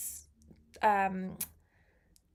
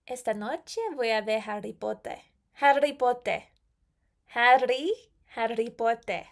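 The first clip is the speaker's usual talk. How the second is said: Spanish-accented "Harry Potter"; Spanglish accent. Shown as a demonstration. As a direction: say it as a Briton speaking Spanish, tapped r. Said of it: "And then in a Spanglish accent".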